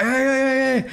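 high, loud, excited hey hey; excited greeting. Retold or imitated. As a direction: greet him like an excited fan. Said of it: "they're like".

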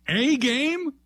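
A game, scoffing; incredulous. Acted. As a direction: spit the word back at him. Host mocking the coach's words.

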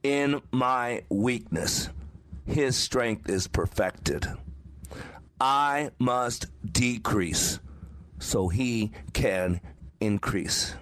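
slow, deliberate recitation, each phrase set apart; a measured, solemn creed said aloud; reciting mantra. Shown as a demonstration. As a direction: speak the mantra slowly to steady yourself. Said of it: "I espoused my mantra out loud".

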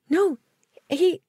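sudden shrill-free panic at being fired; panicked protest. Retold or imitated. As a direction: blurt it out in alarm. Fired, protesting to her boss.

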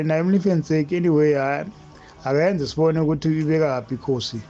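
ordinary talk, nothing marked

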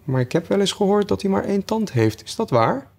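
high-pitched, curious Alice; inquisitive Alice. Acted. Alice asking the Hatter about time, high and curious. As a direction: pitch it well up, innocently asking.

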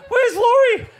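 very high, loud frantic question; panicked call. Retold or imitated. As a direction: shout the name in alarm. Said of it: "And we're like".

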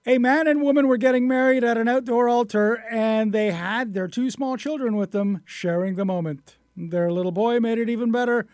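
high, projected opening to a radio news story; lively human-interest news read. Acted. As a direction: start big and lifted, then ease toward the clip.

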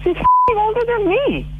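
high, slow, disbelieving; stunned mother; shocked outburst. Real, not acted.

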